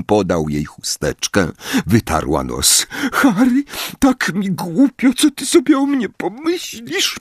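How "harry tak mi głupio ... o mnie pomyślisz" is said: high, tearful, embarrassed; shaky apology to Harry; trembling, much higher. Acted. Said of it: "powiedziała drżącym głosem".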